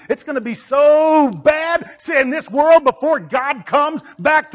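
loud, high, impassioned sermon; full-force preaching. Acted. Preacher demanding congregation decide whose side, full-force shouted preaching.

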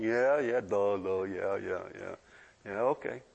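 low, dopey toddler imitation; clueless toddler. Imitating an uncomprehending two-year-old.

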